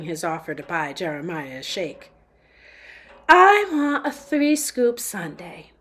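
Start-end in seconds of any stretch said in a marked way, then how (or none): 3.3-5.7 slow, higher Southern drawl mixed with upper crust British; a fussy customer in a half Southern, half posh British accent; drawn-out Southern-British accent ordering ice cream. Acted. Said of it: "in a strange accent that was half Southern drawl and half upper crust British".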